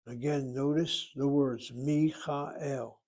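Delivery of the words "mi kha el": "Michael" in Hebrew, mee-kha-EL; three syllables. Shown as a demonstration. Teacher has class notice the word, three syllables. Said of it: "Three syllables".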